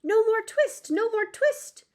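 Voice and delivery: high, loud squeaky mouse chorus; little mice jeering out of the window; twittering mice shout. Acted. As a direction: shout it like tiny mice all at once. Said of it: "shout at once in little twittering voices".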